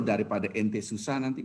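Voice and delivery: low-pitched grudging remark; unpleasant grudging tone. Acted. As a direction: mimic a giver's disagreeable tone. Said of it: "Ngomongnya itu nggak enak banget".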